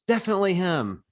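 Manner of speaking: imitating the fan, high and instant; blunt fan answer. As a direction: fire it back with zero hesitation. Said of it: "like, no hesitation at all".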